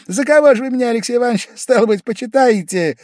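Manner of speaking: high, loud accusing question; a small man finally bristling; wounded challenge. A narrator's reading. Pavel Pavlovich demanding of Alexei Ivanovich, high and loud.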